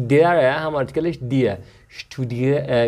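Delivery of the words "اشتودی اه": normal talking voice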